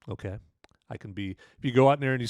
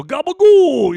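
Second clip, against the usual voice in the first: mobster-style gabagool, pitched up and loud; New Jersey mob accent; 14 dB louder, slower than usual. Pretend. Sopranos mob vibe bit.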